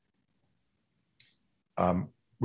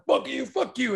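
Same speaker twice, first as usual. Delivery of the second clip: mock street taunting. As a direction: imitate a street aggressor in a high voice. Imagined street aggressor goading someone.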